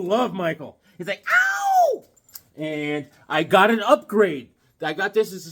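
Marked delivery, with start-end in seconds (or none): 1.3-2.0 very high falsetto yelp. Retold or imitated. Host mimicking [name]'s cry, falsetto yelp. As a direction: yelp like a pop star.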